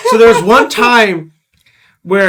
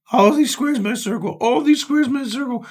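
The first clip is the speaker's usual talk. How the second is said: soft, higher repeated chant, imitating; starts low; 4 semitones higher, 6 dB quieter than usual. Imitating the ringtone heard over and over, soft chant. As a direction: repeat it softly like a looping ringtone. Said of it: "it starts low and it gets louder".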